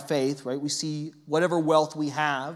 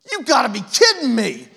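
louder, higher mock outburst of dismay; retold yell; 7 semitones higher, 7 dB louder than usual. A girl sees her plane seatmate and yells in mock dismay. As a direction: blurt it out as if shocked at your seatmate. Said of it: "yells it through the plane".

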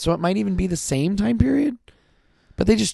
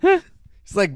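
usual speaking voice

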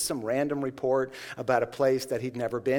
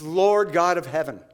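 prayer voice; 6 dB louder than usual. Retold or imitated. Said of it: "He says".

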